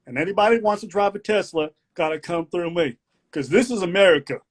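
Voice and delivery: hammed-up Southern patriot lecturing; mock Southern rant. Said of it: "One of my favorite segments".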